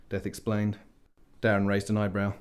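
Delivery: ordinary delivery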